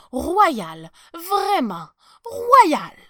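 loud, high, climbing to the last word; admiring exclamation. A narrator's reading. Archbishop flattering the emperor's outfit, exclaiming. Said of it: "s'exclama l'archevêque".

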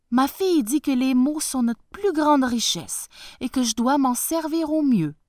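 high, loud humble peasant voice; bow and scrape to the tsar; humble farmer. Acted.

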